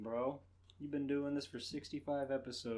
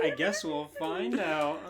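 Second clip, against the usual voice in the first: laughing imitation; 2 semitones higher, 9 dB louder than usual. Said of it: "[name] is just mocking her".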